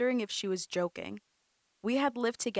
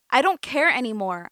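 loud, higher, fed up cry; 4 semitones higher, 10 dB louder than usual. A narrator's reading. Amanda cries out to her cousin, fed up. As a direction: cry out, done with it all. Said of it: "I cried in response".

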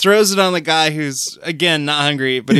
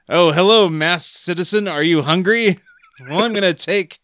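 higher, brighter hero voice; grandiose superhero; 4 semitones higher than usual. Retold or imitated. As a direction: greet him like a cartoon hero. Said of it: "like a grandiose superhero".

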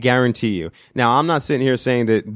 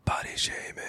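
whispering the phrase; whispered; 13 dB quieter than usual. Shown as a demonstration. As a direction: breathe it out in a whisper. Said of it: "but I'm gonna use it once".

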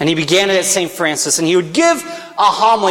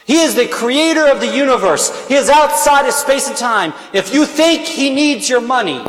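full-force preaching; 5 semitones higher than usual. Acted. Priest challenging the parish to give, full-force preaching. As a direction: preach it out to the whole church.

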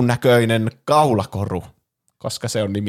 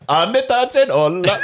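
Finnish put on in a high, slow Swedish accent; hammed-up Swedish accent on Finnish words; mock Swedish accent; 10 semitones higher, 5 dB louder, slower than usual. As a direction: say it like a Swede trying Finnish. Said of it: "ruotsalaisella aksentilla".

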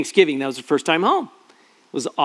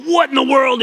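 higher, louder disbelief at the potholes; exasperated; 8 semitones higher, 4 dB louder than usual. Retold or imitated. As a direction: blurt it at the bumpy road. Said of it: "you're just going".

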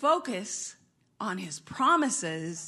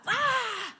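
high sharp startled cry; mock startled shout; 12 semitones higher than usual. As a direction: shout as if caught by surprise. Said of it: "she's like".

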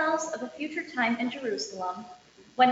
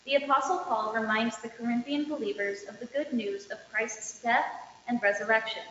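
normal talking voice

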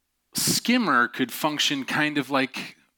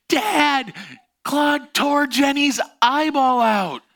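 very high, loud, slow hysterical cry for help; frantic yell to dad; 14 semitones higher, 6 dB louder, much slower than usual. Retold or imitated. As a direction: scream for your father across the yard. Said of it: "she goes".

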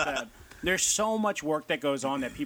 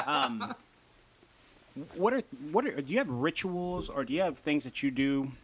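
ordinary talk

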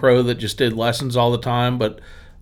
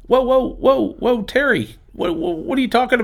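startled protest; 11 semitones higher than usual. Retold or imitated. Retelling reaction to Terry's jab.

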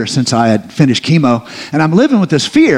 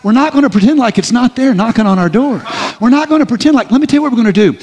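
building preaching; 5 semitones higher, faster than usual. Real, not acted. Preacher rousing the congregation about fear, rising preaching. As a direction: drive the point home to the crowd.

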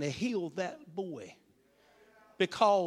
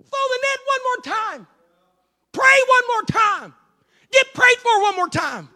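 high, loud urging; shouted exhortation; 11 dB louder than usual. Acted. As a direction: urge them on, shouting.

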